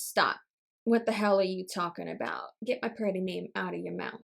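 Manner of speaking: low, soft, murmured singer impression. Vlogger quoting a pop song from a reel, low impression. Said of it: "That was my impression of [name]".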